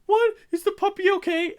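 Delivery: sudden very high alarmed squeal about the puppy; alarmed squeak. Retold or imitated. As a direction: shoot up high and loud, a mock worried girl. Animal handler voiced, alarmed.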